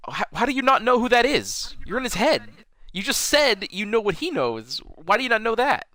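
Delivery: high, exasperated disbelief; incredulous. Real, not acted. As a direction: ask it like it makes no sense. Ranting about a plot hole.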